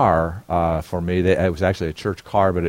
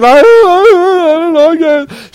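high, loud, stammering sob; a guilty teen breaking down; crying babysitter; 12 dB louder than usual. Retold or imitated. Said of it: "the babysitter's crying".

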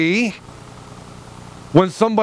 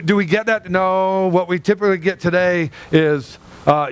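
ordinary talk

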